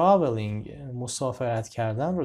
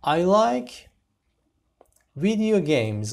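announced sentence; 6 semitones higher, 6 dB louder, much slower than usual. Shown as a demonstration. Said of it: "teacher announces new sentence".

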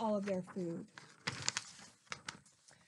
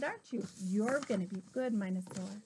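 plain reading voice

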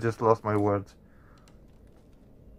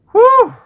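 sharp high yelp of fright, much louder; startled yelp; 14 dB louder than usual. Real, not acted. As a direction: jolt out a loud high whew as the scare hits. Jump scare in horror game.

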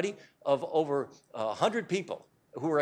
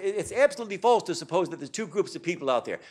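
ordinary delivery

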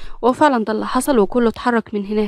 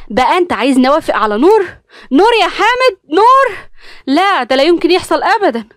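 high, loud outraged cry; wife yelling at her husband in outrage; furious refusal; 7 semitones higher, 5 dB louder than usual. Acted. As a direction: shriek it in disbelief, then refuse. Wife's furious refusal to the marriage.

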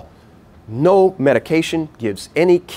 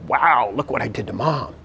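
put-on kid's brain impressed. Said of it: "their brain does is go".

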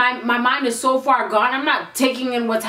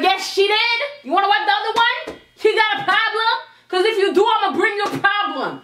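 higher, confrontational challenge; 8 semitones higher than usual. Retold or imitated. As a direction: dare the friend to start something.

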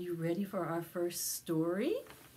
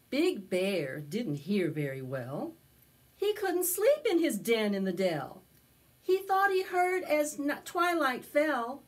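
storybook narration; 5 semitones higher, 6 dB louder than usual. A narrator's reading. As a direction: tell it to a circle of little kids. Storytelling continues.

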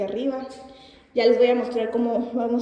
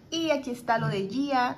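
ordinary talk, nothing marked

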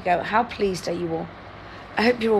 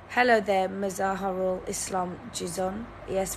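usual speaking voice

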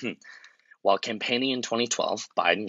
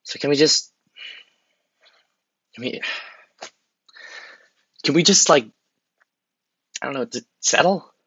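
begging plea; 5 semitones higher than usual. Acted. Asking the American people to settle, pleading. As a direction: beg them, stumbling over words.

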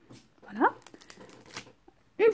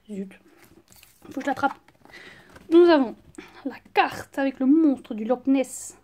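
normal talking voice

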